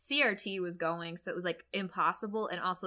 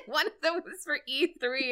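high laugh spilling into the words; 10 semitones higher, 6 dB louder than usual. Real, not acted. Cohost laughs telling how she found the tabs, laughing high. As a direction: giggle the words out, higher and louder.

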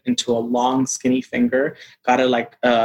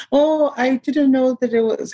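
fake innocent; 12 semitones higher than usual. Faking innocence at a party.

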